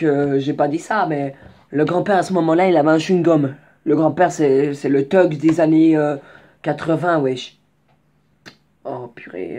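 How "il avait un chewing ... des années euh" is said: normal talking voice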